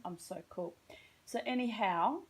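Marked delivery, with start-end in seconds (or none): 1.2-2.2 "anyhow" in a plain Australian accent, rising; "anyhow" in her own Australian accent. Shown as a demonstration. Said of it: "I will amend to my Australian status".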